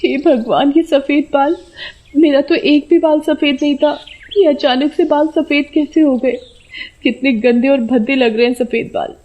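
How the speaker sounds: dismayed woman. A narrator's reading. (परेशान औरत की आवाज़)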